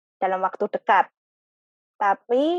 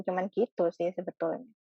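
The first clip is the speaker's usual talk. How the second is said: usual speaking voice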